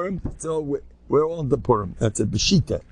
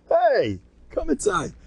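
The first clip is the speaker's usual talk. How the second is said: much higher, mock-cheerful welcome; joking invitation; slower than usual. Sick man jokingly inviting rabbi to his funeral, high mock cheer. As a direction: call them in like a cheery host at his own funeral. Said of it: "I'm joking".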